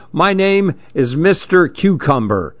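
plain reading voice

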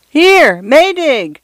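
high calling cry for a friend; high, pleading shout for help; feeble scream. A narrator's reading. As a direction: call out desperately into the wind. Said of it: "screamed Mister Fotheringay's feeble voice".

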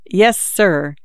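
ordinary delivery

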